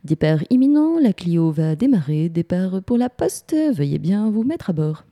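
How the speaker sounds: rough attempt at a train announcer; SNCF station announcer. Shown as a demonstration. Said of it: "faire la voix S N C F".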